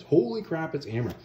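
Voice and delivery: high-pitched astonished crowd reaction, retold; mock astonishment, starting high; amazed recognition. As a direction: imitate a crew suddenly recognizing someone. Galley crew recognize their old leader.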